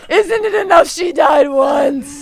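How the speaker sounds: high shrieking melodrama wail; dramatic wailing shout. Acted. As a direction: wail it like a tragic heroine. Sudden dramatic outburst at cough.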